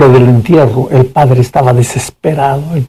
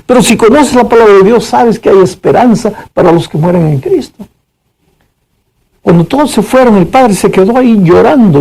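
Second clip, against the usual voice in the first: usual speaking voice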